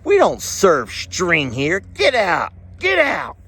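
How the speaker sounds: slow, high, rising to a shout of get out; bartender kicking him out. Acted. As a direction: throw the customer out in a put-on barman voice. Bartender throws string out.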